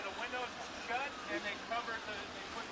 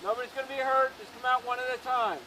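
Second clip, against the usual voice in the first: booming PA order to come out; loudspeaker announcement; 7 dB louder than usual. Real, not acted. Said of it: "If you can announce again".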